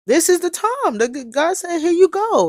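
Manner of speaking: high, urgent burst; excited proclamation. Acted. Host relaying God's message to listeners, excited proclamation. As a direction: proclaim it like a big revelation.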